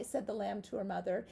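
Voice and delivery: usual speaking voice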